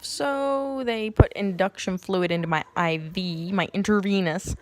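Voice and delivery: ordinary talk, nothing marked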